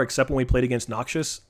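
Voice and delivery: usual speaking voice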